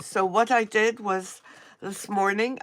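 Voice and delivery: usual speaking voice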